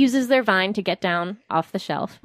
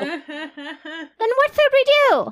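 panicked character; 8 semitones higher, much slower than usual. Acted. Emmy panicking, laughing.

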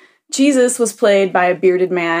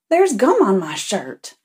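indignant Southern-style churchgoer; 2 semitones higher than usual. Retold or imitated. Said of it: "he starts getting upset".

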